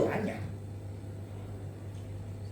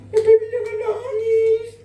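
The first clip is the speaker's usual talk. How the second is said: very high, drawn-out mock wail; imitated crying child; 14 semitones higher, 4 dB louder than usual. Small child begging daddy to stop crying, imitated high wailing. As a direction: plead tearfully in a tiny child's voice. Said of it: "dia ikut nangis".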